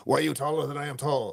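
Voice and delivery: a hint of an odd foreign role-player; lightly voiced strange accent; mildly put-on weird voice. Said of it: "talking in this weird voice".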